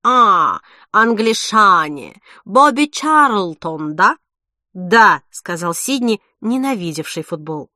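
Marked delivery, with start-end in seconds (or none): 0.0-4.2 chef voice. A narrator's reading. Boisterous chef to English couple. 4.7-5.2 loud answer. A narrator's reading. Sidni answers chef loudly.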